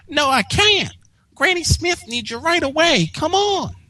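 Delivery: high little filly calling out; young filly announcing the mail; Apple Bloom voice. Acted. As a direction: squeak it like an excited kid sister.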